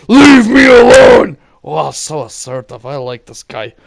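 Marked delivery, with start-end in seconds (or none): 0.1-1.4 furious high scream; raging shout, higher and louder. Retold or imitated. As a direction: cut her off by screaming. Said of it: "I cut her off with".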